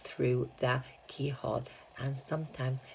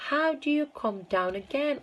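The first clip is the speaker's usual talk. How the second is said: put-on little boy's question; 12 semitones higher, 3 dB louder than usual. A narrator's reading. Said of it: "asked little Charlie".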